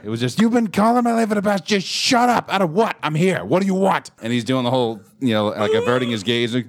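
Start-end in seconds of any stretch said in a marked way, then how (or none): 0.4-4.1 confronting the bully with a raised, higher yell; drill sergeant yell. Retold or imitated. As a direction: get in his face like a drill sergeant. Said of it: "go drill sergeant on his ass".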